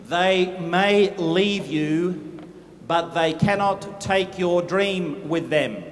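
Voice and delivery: low and very slow, weighing each word; slow solemn promise. Acted. To the business group, a slow low solemn vow. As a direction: hand them the promise slowly. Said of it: "Listen to me".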